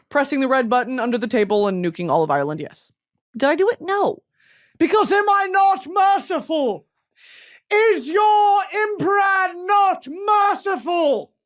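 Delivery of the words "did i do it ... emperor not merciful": high, slow, grand imperial proclamation; booming emperor voice, drawn out; merciful emperor. Acted. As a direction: pronounce mercy like a vain tyrant addressing his subjects. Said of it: "Me to Ireland".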